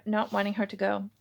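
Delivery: usual speaking voice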